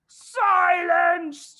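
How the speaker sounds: furious shout. A narrator's reading. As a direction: silence the hall. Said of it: "shouted the Trunchbull".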